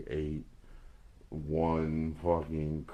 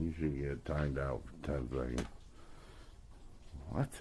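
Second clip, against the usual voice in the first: normal talking voice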